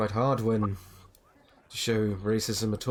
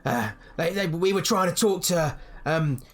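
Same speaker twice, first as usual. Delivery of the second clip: much higher, loud mocking drunk with laughs; drunk local; 7 semitones higher, 3 dB louder than usual. Drunk villager mocking the adventurers to his friend, high and laughing. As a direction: jeer at the strangers, laughing.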